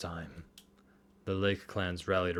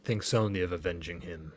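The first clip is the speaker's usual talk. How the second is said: normal talking voice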